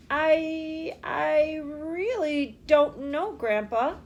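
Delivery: slow, halting stammer. A narrator's reading. Charlie unsure before his grandfather, stammering. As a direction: stumble over the words, unsure. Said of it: "Charlie stammered".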